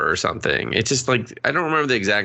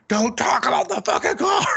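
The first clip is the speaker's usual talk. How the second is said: mock angry shout. Stephen King snapping at the interviewer, mock shout. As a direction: bark it back like an offended author.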